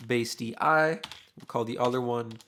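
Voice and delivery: normal talking voice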